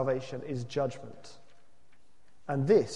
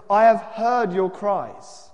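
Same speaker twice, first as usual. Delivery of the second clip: loud, slow proclamation; different voice; 4 semitones higher, 8 dB louder, slower than usual. A narrator's reading. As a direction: announce it slowly and grandly. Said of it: "there's a different voice".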